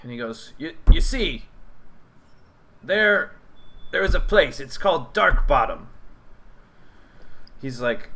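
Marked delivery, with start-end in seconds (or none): 0.6-5.9 a lightly raised goblin voice, halting; a mild high goblin, pausing to tell a secret. Acted. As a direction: goblin warning travellers of Dark Bottom.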